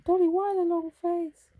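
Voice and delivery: mock concern. Teasing the cat, loud high.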